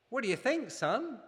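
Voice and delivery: a cheerful father asking his son; father's voice. Acted. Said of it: "the daddy asked".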